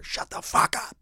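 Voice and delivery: a hint of a menacing stranger, unvoiced; menacing voice hissed from across the alley; scary guy's voice. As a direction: rasp it out like a threat through the wall. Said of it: "It was like a, a scary guy's voice".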